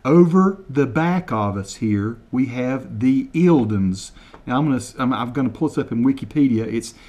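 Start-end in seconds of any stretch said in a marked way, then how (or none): 0.0-4.1 slow, deliberate over-enunciation of each word; slow mock translation. Shown as a demonstration. Translating Kevin's Scottish for viewers, slow and deliberate. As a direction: spell it out slowly for Americans, deadpan. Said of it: "translate word for word".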